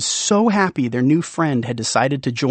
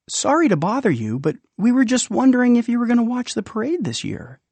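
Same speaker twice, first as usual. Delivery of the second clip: sheepish; 8 semitones higher, faster than usual. Acted. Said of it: "Rumpel sheepishly replied".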